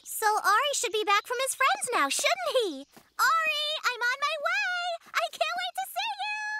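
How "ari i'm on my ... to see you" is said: high eager calling; excited calling out. Acted. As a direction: call out eagerly, pitched up. Child calling out to doll.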